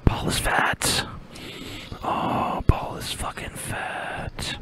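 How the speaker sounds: slow taunting whisper; mock whisper. Teasing Paul with a slow whisper.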